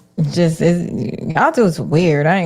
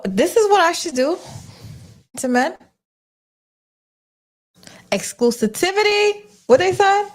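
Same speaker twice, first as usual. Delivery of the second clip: high, disbelieving squeak; can't believe what you just heard; incredulous; 11 semitones higher than usual. Real, not acted. Incredulous at AI advice.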